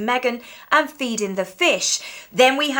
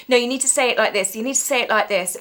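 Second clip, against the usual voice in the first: bossy mother; faster than usual. Retold or imitated. Imitating Meghan drilling kids.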